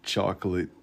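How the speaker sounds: put-on soft, drawn-out "Chocolate" in the lady's accent; imitating the chocolate lady's accent. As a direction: quietly copy her accent on "Chocolate". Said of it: "she got an accent the way that she would say it".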